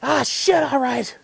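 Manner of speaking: imitating a reluctant confession, high and loud; sheepish confession. Hunter giving in and confessing to the guide, mock exasperated. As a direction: finally crack and admit it. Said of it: "He goes".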